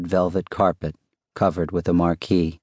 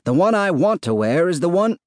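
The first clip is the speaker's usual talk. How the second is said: high, insistent holler, cut off; shouted; 11 semitones higher, 3 dB louder than usual. A narrator's reading. Husband arguing with wife over scarf, shouted. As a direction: shout it down until interrupted. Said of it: "Andrew shouted".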